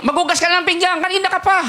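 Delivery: high mock yell at a lazy kid; yelling parent. Preacher mimics a parent yelling at a child to wash dishes, high mock shout. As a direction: bark the chore order like a fed-up mom.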